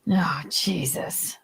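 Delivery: slow breathy whisper of disgust; slow whispered "Oh, Jesus"; whispered exasperation. Real, not acted. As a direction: whisper it in disbelief.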